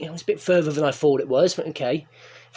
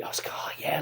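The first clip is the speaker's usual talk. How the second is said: whisper; 4 semitones lower, 12 dB quieter, faster than usual. Retold or imitated. Whispered retold directions and reply.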